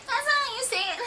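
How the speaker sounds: high, soft giggling mockery; mocking laughter. Native speaker laughing at a learner, mocking high giggle. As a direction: imitate someone laughing through a correction. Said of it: "laughing at them".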